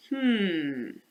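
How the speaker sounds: a sly thinking "hmm"; scheming snake. Acted. Snake plotting to swallow Lark, sly thinking hum. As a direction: muse like a sneaky snake.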